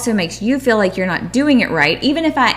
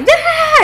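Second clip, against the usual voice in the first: put-on high excited kid squeal, pitched way up; imitated kid shriek; 5 dB louder than usual. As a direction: shriek like a hyper kid running around. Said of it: "they're running around like".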